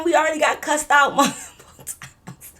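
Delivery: high, laughing through the words. Real, not acted. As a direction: laugh out the complaint. Laughing while exasperated.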